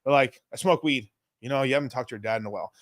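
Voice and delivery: normal talking voice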